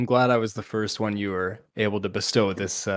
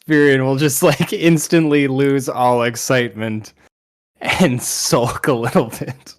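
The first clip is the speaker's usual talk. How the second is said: laughing; 4 semitones higher, 7 dB louder than usual. Real, not acted. Laughing while narrating.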